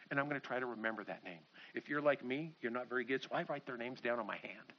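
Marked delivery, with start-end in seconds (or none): none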